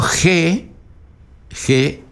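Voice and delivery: "G" said as he, breathy h and oral e; oral vowel. Shown as a demonstration. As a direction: say "G" as Spanish je, nothing through the nose. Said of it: "le agrego una nasal".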